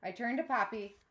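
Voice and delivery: usual speaking voice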